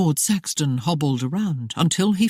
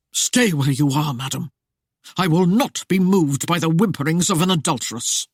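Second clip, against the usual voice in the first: curt. Acted. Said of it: "His curt tone brooked no disobedience".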